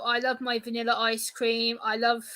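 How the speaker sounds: usual speaking voice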